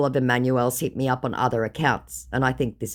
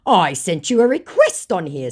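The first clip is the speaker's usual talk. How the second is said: lightly voiced scammer, a bit high and loud; mock scammer; 4 semitones higher, 6 dB louder than usual. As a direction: read the scammer's message mockingly. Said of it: "said my scammer".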